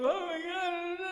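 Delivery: put-on high whimper of a crying child; small boy wailing after an accident, high-pitched; crying kid. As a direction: play the upset little boy.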